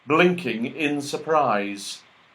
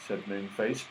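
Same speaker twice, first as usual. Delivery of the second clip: ordinary delivery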